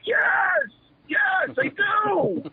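very high, strained drawn-out shout; retold yelling. Wrestler yells back at his chief that he wants it, desperate. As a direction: re-enact a desperate shouted plea. Own yelled answer in retold quarrel.

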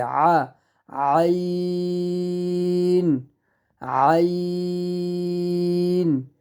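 আ squeezed, then আইন held very long; throat-pressed আইন. Shown as a demonstration. Teacher drilling students, আইন throaty and drawn out. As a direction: squeeze mid-throat and stretch আইন level. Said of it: "গলাটা চাপ দিয়ে উচ্চারণ করতে হবে".

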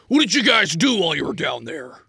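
high, loud scolding smith; agitated smith. Acted. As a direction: scold them for disobeying. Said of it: "visibly agitated".

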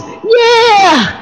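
very high, stretched yell of "yeah"; Tyler. Retold or imitated. Preacher demonstrating Tyler's talk, high put-on voice. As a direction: put on a squeaky-high boy's changing voice. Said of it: "that's how he talks".

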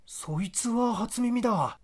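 a provincial elder brother, pressing for answers; rustic older brother. Acted.

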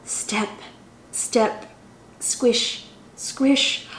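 whispered squish sounds. Acted. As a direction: hush it as if sneaking through. Tiptoeing through dark forest.

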